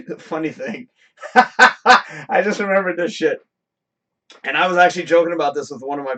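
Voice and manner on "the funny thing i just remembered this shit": breaking into laughter mid-sentence; laughing while talking. Real, not acted. Recalling his valet job to viewers, laughing as he talks. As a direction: laugh through the words, slowed.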